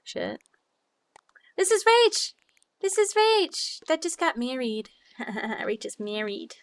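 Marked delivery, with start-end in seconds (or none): 1.6-4.9 a squeal, much higher and louder; excited realization. Real, not acted. Recognizing the newly married chatter, squealing with delight. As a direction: shout the name in delighted recognition.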